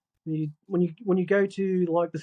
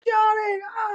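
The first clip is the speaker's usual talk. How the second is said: loud, high mock yell; high calling shout; shouting to friend; 14 semitones higher, 4 dB louder, slower than usual. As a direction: imitate a man yelling for his mate. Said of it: "he's shouting his friend".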